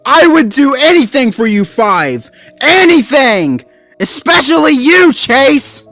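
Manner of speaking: high, loud pup shouting at a friend; furious shout. A narrator's reading. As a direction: shout it right at Chase. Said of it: "shouted Marshall".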